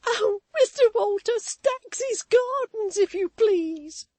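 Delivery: high, loud, slow sobbing plea; tearful plea. A narrator's reading. Maid pleading with Walter for Staggs's Gardens, slow tearful wail. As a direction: wail each word through tears. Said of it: "said Susan".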